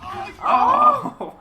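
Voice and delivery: real high, loud cry of shock; sudden high shout on seeing it go wrong; shocked yell. Real, not acted. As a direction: yell out at a painful mishap. Wincing as the foot stunt fails.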